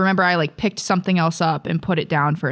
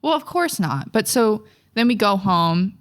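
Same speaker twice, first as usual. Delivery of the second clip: ordinary talk, nothing marked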